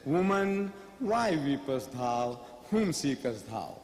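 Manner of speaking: slow, low biblical cadence for Jesus; solemn scripture voice, low and unhurried; Jesus quoted. Acted. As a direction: intone the King James words slowly and gravely. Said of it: "So he says".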